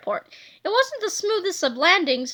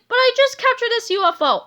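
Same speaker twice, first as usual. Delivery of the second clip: higher, raised hero announcement; a louder heroic call; hero shouting; 8 semitones higher, 4 dB louder than usual. A narrator's reading. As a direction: keep shouting to the crowd. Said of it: "Captain Underpants shouted".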